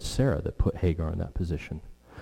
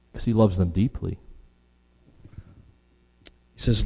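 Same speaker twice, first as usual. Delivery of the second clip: normal talking voice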